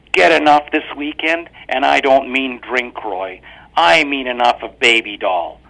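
corner him with each word; accusing wife. A narrator's reading. Wife confronts husband.

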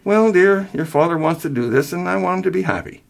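high-pitched, hesitant mother. A narrator's reading. Mother answers her son about father's plan, hesitant. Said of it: "she hesitantly said".

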